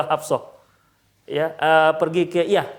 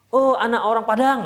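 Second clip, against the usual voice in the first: higher-pitched sing-out jeer; mock taunt; 5 semitones higher, 4 dB louder than usual. Teasing someone over where they come from, mocking. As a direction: throw it like a teasing insult. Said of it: "kalau ada saling ejek mengejek".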